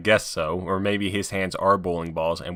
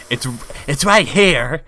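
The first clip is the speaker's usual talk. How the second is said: louder, slow, pointed repeat; exaggerated redo; 4 semitones higher, 5 dB louder, slower than usual. Shown as a demonstration. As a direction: say it slowly on demand. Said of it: "if you want me to say it".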